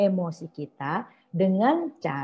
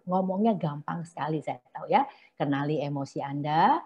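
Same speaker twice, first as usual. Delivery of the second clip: normal talking voice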